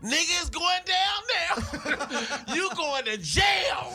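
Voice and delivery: high, drawn-out holler with laughter around it; hollered skit cry. Acted. Comic acts out a new school fight skit, hollering high. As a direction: stretch each word into a high-pitched cry.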